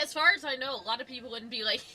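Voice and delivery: usual speaking voice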